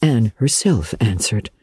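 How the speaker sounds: ordinary delivery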